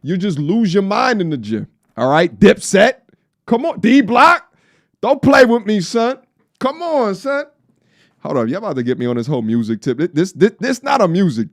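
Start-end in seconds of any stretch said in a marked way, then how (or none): 2.4-7.4 much higher and louder hype calls; hype shout. Acted. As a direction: shout it like a crowd hype man. Hyping Dipset music.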